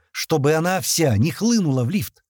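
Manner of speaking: plain reading voice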